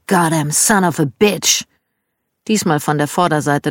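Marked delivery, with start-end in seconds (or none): 0.1-1.7 mild Southern curse. Retold or imitated. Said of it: "ertönte ein weiteres".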